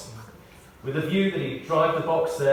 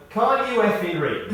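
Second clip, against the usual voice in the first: higher, louder mock-indignant snap; exasperated retort; 7 semitones higher, 5 dB louder than usual. Ted to the policeman after being booked, indignant retort. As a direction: throw it back at the copper, fed up. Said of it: "Ted was somewhat less apologetic now".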